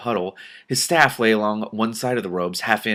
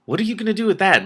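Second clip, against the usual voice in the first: curious question, pitched higher and louder; 11 semitones higher, 5 dB louder, faster than usual. A narrator's reading. Mendenbar asking Simran about the staff, curious. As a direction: ask it with real curiosity. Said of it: "Mendenbar asked curiously".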